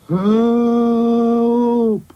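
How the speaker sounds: long, loud, high wail; cry for help. Acted. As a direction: call out stretched and desperate. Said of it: "he cried".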